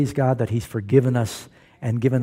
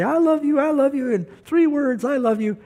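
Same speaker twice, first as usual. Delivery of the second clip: high, hammed-up drunken pleading from below the window; mock-bellow it up to her window; drunk husband's bellow; 12 semitones higher than usual. Drunk husband pleading up to his wife's window, hammed-up high bellow. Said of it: "He'd say".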